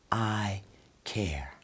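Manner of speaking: soft and slow, pausing between words; slow soft I care. Shown as a demonstration. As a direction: drop very low and soft, sincere. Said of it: "you wanna speak slow".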